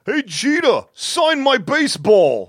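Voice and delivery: put-on loud, high, slow New York fan accent. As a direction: yell it like a Bronx fan in the stands. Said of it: "That was my New York accent".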